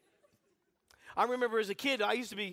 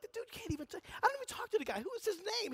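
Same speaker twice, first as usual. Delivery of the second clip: put-on high, disbelieving kid voice; indignant kid; 12 semitones higher, 4 dB quieter than usual. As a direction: squeak it out, baffled and offended. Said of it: "I'm like".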